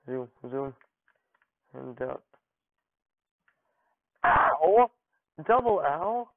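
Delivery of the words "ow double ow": loud, high "ow" played up; hammed-up cries of pain; mock pain yelp. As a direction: yelp as if hit in the game. Game character gets hit.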